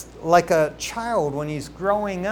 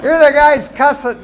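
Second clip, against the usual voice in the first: louder, higher, disbelieving; incredulous outburst; 8 semitones higher, 11 dB louder than usual. Retold or imitated. Preacher recalling his reaction to a cussing biker, incredulous. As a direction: blurt it out in disbelief.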